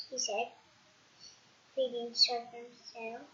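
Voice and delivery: ordinary delivery, nothing marked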